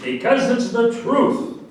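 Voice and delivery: indignant. Acted. Heated quarrel over Abkar.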